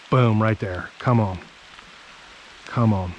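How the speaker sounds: ordinary delivery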